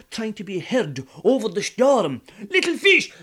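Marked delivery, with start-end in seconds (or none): 2.5-3.1 high, loud call with a laugh; yelling. Acted. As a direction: call out over the storm. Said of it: "he yelled out".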